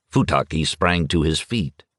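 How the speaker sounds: ordinary delivery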